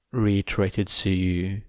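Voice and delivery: usual speaking voice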